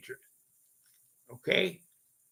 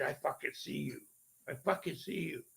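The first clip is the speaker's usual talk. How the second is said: much softer, pressed and intent, as if cornering someone; 12 dB quieter than usual. Retold or imitated. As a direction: say it right at her, barely above a breath. Confronting the ghost in bedroom.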